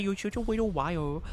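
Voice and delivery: put-on teasing baby voice, l turned to w; baby talk. Streamer teases chat as a little liar in baby talk. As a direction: coo it like scolding a toddler.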